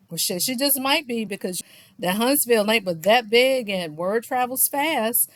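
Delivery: usual speaking voice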